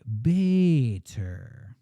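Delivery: "Bader" very slow and drawn out, tender; thoughtful and tender. Shown as a demonstration. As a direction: stretch "Bader" out tenderly, as if musing. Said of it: "say it thoughtfully and tenderly".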